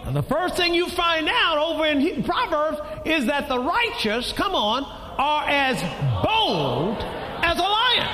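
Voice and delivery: rising preaching cry. Acted. Preacher building to a high shout on bold as a lion.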